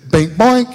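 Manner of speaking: loud, high "bink, boink"; punchy cartoon bounce sound; imitated bouncing noise. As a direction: mimic a little object ricocheting. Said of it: "that thing said".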